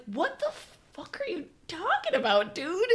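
high, baffled what-the-hell; incredulous outburst. Retold or imitated. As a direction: squeak out total disbelief. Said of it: "And I was like".

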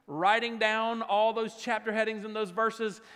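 ordinary talk, nothing marked

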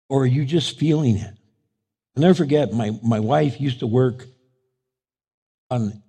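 ordinary talk, nothing marked

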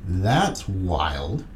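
low, drawn-out astonished whoa; mock amazed whoa. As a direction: play your own stunned reaction, low and slow. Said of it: "I'm like".

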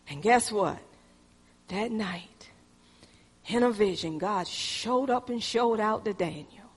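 soft, low confiding tone; hushed reveal. Acted. Preacher softly revealing God's vision to Daniel. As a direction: lean in and let them in on a secret.

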